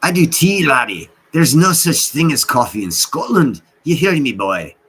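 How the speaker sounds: loud, high mock Scottish brogue; shitty Scottish accent. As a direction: bark it like a bad Scottish hardman. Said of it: "it's a shitty Scottish accent".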